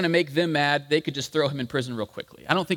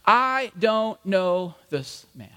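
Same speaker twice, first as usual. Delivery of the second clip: slow, word-by-word oath; deliberate, insistent disavowal; sworn denial; 3 semitones higher, much slower than usual. Acted. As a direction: swear it emphatically, spacing every word. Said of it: "with an oath this time".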